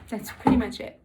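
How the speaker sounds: talking while chewing gum; words round a wad of gum. Real, not acted. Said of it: "Sorry, I'm chewing gum".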